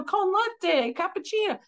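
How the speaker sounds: high, sing-song Italian coffee words. Retold or imitated. Practicing Italian for her kids, playful high sing-song. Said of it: "I'm like, you know".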